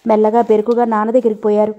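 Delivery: ordinary delivery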